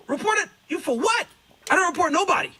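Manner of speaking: high-pitched retold incredulity; indignant disbelief. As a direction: throw the accusation back, baffled. Retelling his baffled reply to accusation.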